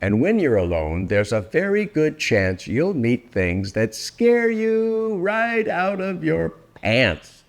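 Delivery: ordinary delivery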